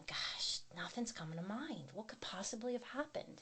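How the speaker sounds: breathy whisper of a worried thought; whispering her panicked inner thoughts while pouring coffee. Retold or imitated. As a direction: whisper it to yourself, anxious. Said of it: "I'm just thinking".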